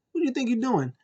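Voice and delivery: mock indignant, pitched high; indignant challenge. Wrestler confronting the referee, mock indignant.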